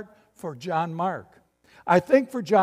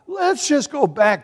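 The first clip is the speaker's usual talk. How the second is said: coaxing Barnabas; 7 semitones higher, 5 dB louder than usual. Retold or imitated. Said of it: "Barnabas says".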